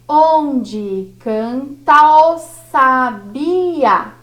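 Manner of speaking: very slow, each syllable stretched; teacher scanning a line, very slowly; slow scansion. Shown as a demonstration. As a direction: spell out the verse beat by beat. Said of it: "Vamos pro segundo verso".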